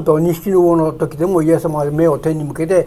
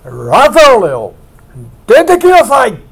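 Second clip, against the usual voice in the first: loud call; 5 semitones higher, 5 dB louder than usual. Retold or imitated. Preacher voicing Jesus at the tomb, a loud commanding call. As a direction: call a dead man out of the tomb. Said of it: "と大声で言ったわけです".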